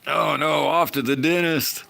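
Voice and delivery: high, loud mock horror at the dentist; mock dismay. Real, not acted. Streamer reacts to a viewer's dentist trip, high mock horror. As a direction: squeal a jokey yeeks.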